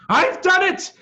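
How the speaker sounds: high, loud cry of triumph; excited yell of success. A narrator's reading. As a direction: shout it out in pure glee. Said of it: "he yelled".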